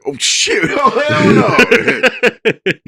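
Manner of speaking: high, slow, laughing "oh hell no"; drawn-out disbelief with a chuckle; mock shock. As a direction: uncle hamming up his outrage. Uncle reacting to godson in story.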